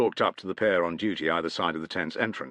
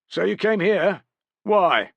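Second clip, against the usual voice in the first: higher, louder foreign warrior; lightly raised warrior voice; 13 semitones higher, 7 dB louder, slower than usual. A narrator's reading. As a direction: voice the eager deserter. Deserter warrior answering at gate.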